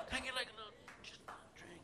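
put-on a faint whisper of a drunk begging; whispered drunk plea. Drunk whispering a plea for a little drink, faint whisper. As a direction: barely breathe the plea for a drink.

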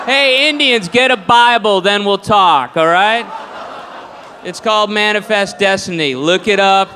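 loud, smug shouting settler; a loud hammed-up smug colonist; mock arrogant colonist. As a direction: bellow it like a self-righteous pioneer.

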